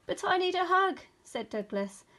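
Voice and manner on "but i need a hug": high, loud plaintive protest; pleading. A narrator's reading. Douglas to the rabbit, pleading. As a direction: plead like an upset little bear.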